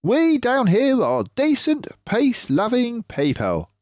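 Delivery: clever fox leader, pleased with himself; Mister Fox voice. Acted.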